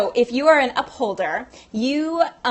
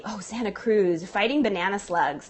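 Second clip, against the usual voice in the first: ordinary delivery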